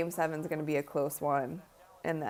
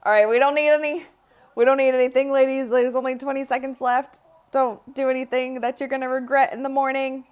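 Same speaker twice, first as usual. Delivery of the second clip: raised, louder and higher plea; urgent calling out; 6 semitones higher, 6 dB louder than usual. Real, not acted. Streamer urging the team with seconds left, calling out. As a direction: call out to the players, pitched up and insistent.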